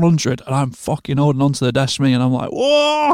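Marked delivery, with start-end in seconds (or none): 2.5-3.1 long, high "whoa"; awed "whoa". Retold or imitated. Mixer holding on to the desk, retold high cry. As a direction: hold a high mock cry of being overwhelmed.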